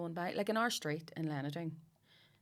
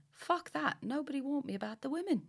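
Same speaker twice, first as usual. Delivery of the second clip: higher-pitched imitated Londoner; London accent; 8 semitones higher than usual. Husband dismissing warnings about Belfast, mock London accent. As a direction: retell it as an incredulous London husband. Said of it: "He's from London".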